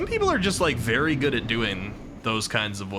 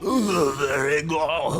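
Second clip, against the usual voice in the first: strained voice; 2 semitones higher, much slower than usual. Retold or imitated. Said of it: "Ow, my throat".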